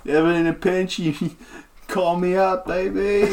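swagger it like a washed-up rock star; laid-back bard introducing himself, cocky. Acted. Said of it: "he's like".

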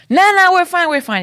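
high-pitched mock brush-off; dismissive reassurance. Friend refusing to drop the fish, mock dismissive. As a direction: imitate a friend waving off the danger.

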